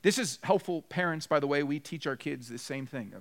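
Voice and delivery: ordinary talk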